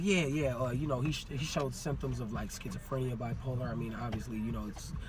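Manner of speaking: low, very soft confiding voice; hushed, low-pitched mock officer; imitated officer's report. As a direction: quietly pass on a damning report. Officers slandering him to hospital staff.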